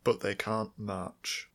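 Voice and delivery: very slow, falling and firm; slow insistence. Real, not acted. Insisting to a friend fish cannot march, slow and firm. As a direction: plant each word slowly. Deadpan repetition.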